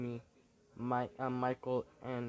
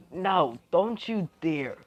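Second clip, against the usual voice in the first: loud, high, drawn-out warning; sharp forbidding shout; 6 semitones higher, 11 dB louder, slower than usual. Acted. One character warning another not to dare, shouted high and slow. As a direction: forbid it loudly, stretching the words.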